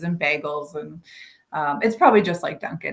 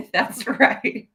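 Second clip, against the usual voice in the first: laughing; 5 semitones higher than usual. Real, not acted. Laughing after a slip.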